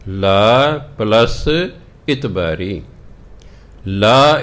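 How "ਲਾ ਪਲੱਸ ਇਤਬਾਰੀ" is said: ਲਾ then ਇਤਬਾਰੀ, split and slow; syllable by syllable. Shown as a demonstration. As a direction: spell out ਲਾ and ਇਤਬਾਰੀ apart. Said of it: "ਇਹਦਾ ਉਚਾਰਨ ਹੈ ਲਾ ਇਤਬਾਰੀ". (ਹੌਲੀ ਹੌਲੀ ਵੱਖ ਵੱਖ ਉਚਾਰਨ)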